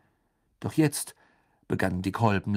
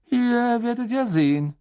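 higher, louder teasing tease-line; teasing secrecy; 13 semitones higher, 6 dB louder than usual. A narrator's reading. Charlie to his curious brothers at the train, teasing. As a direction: tantalise them with what you know.

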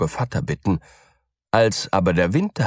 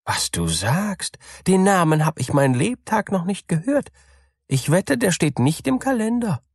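high, quick little mouse voice; a small creature thinking aloud, amazed; baffled mouse; 12 semitones higher than usual. A narrator's reading. Mouse puzzling over the strange name, high and quick.

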